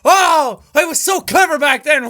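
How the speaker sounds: ordinary talk, nothing marked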